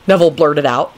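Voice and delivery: plain reading voice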